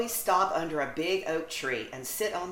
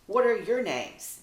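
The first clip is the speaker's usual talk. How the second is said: quacking voice; 5 semitones higher, 6 dB louder than usual. Acted. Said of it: "in their quacking voices".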